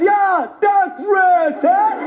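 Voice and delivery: slow, drawn-out Traveller agreement; Irish Traveller accent. Retold or imitated. Traveller catches on and backs him up, slow Traveller accent. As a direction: join in with a mock Traveller accent. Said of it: "one of the travellers".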